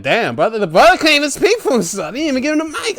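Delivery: high, loud, rushed outburst; indignant protest. Retold or imitated. Protesting for a brother denied the mic, indignant.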